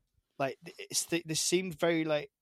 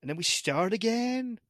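slow middle-American talk with long pauses; imitated slow American accent. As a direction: mimic a slow American, stopping and restarting. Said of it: "They talk a lot slower".

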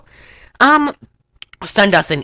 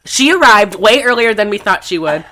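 ordinary talk, nothing marked

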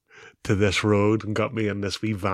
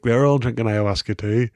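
usual speaking voice

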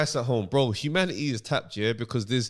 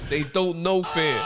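slow and weighty, each word leaned on; dragged-out ominous repeat; slow emphatic; 2 semitones higher, much slower than usual. Real, not acted. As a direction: lay it down slowly like a warning. Second slower emphatic take.

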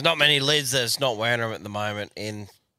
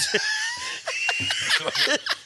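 high screeching laugh; 3 dB quieter than usual. Real, not acted. Laugh after punchline.

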